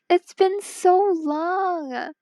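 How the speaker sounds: slow, moved and wistful; touched. Real, not acted. Streamer telling returning viewer it's been long, moved and slow. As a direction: let the reunion get to you. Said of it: "I'm gonna get emotional".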